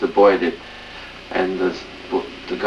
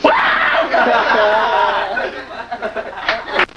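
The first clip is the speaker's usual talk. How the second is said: big laugh; 14 semitones higher than usual. Real, not acted. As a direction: break up laughing at the punchline. Joke punchline lands.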